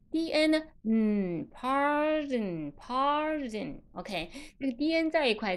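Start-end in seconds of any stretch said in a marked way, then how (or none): 1.5-3.8 very slow "pardon", long first vowel; drawn-out vowel. Shown as a demonstration. Teacher modelling pardon for learners, drawn-out vowel. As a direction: stretch "pardon" and finish on a nasal n. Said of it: "把这个中间的元音拉长了".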